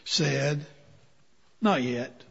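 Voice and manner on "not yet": soft, low and calm; gentle. Acted. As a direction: softly reassure the crying clay. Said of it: "smiled and gently said".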